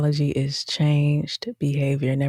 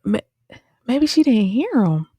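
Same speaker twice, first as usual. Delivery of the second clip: disbelieving reaction; 7 semitones higher than usual. Retold or imitated. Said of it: "I was like".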